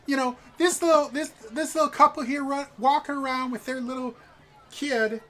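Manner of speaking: high, loud cooing lady; gushing noblewoman. Acted. A noble wife fawning over the players' child, high and loud. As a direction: gush and fawn over the couple's kid.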